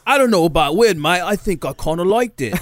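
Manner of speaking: high, hammy British bloke; British accent. Acted. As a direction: camp British lad voice. Friend's voice in bit.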